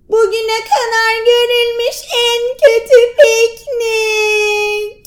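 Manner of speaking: high, slow sung wail; wailing lament. Acted. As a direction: lament it like a sob. Continued wail. (ağlamaklı sincap sesi)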